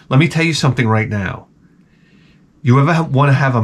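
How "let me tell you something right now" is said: usual speaking voice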